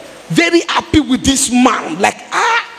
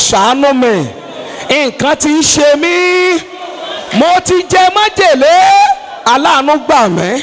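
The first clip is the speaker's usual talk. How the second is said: fervent shouted prayer; 6 semitones higher than usual. Real, not acted. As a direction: cry out to God with full force.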